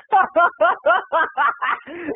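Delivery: long, high-pitched laugh. Acted. Mock laugh before telling disaster.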